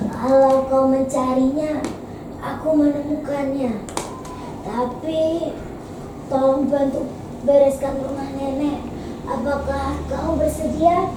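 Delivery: slow, soft granny voice; gentle old woman. Acted. Old woman asking the girl for help, gentle. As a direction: offer a bargain slowly and tenderly. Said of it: "jawab nenek itu dengan lembut".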